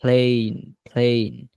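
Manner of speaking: প্লেন repeated as "playn"; long "ay" vowel. Shown as a demonstration. Teacher drills the corrected word, প্লেন as "playn". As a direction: model প্লেন twice with a clear ay glide. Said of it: "শব্দের উচ্চারণ হবে প্লেন".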